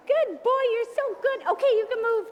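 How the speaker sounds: high, bright and loud praise voice; praise for the dog. Real, not acted. Trainer to dog after he listened, high doggy praise.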